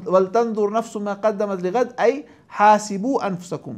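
slow, melodic Quran recitation; Quranic recitation. Acted. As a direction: chant the verse slowly.

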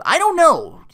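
high, loud acted outburst; exasperated shout. Phil begging Rita for help, shouting in exasperation. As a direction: burst out in mock exasperation.

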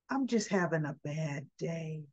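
lightly acted dejection; mock downcast. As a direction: admit it quietly, feeling low. Upset Brooklyn admits bad day.

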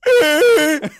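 loud, high braying laugh impression; imitated donkey-like laugh. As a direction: wheeze out a laugh like a donkey giving birth. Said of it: "sounded like, oh, the wind got knocked out of a donkey that she was birthing".

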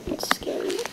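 whispered, scared. Real, not acted. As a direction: breathe it out nervously. Scared of the lava.

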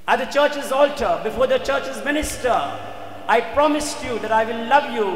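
high, insistent declaration; impassioned acted protest. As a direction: press the vow home as the devoted wife. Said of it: "Jane screamed at him".

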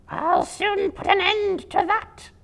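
a furious spider promising to finish the prey; angry hissing spider. Acted. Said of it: "hissed the angry spider".

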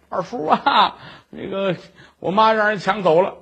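usual speaking voice

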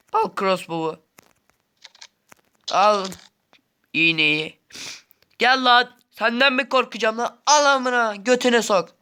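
loud, high furious taunting; being chased. Real, not acted. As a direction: yell at the attacker in rage. Fighting the chasing enemy.

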